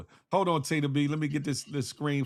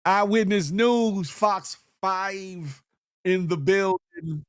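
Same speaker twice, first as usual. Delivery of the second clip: drawn-out, higher announcer call; hype announcer; 5 semitones higher, much slower than usual. Acted. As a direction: introduce a celebrity guest with stretched words. Hypes up a guest introduction.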